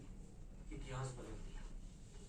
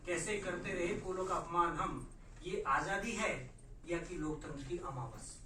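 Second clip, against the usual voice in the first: protest poem recital; 2 semitones higher, 6 dB louder than usual. Acted. Poet asking fellow writers who is to blame, declaimed verse. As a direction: declaim it, then ease into deliberate phrases.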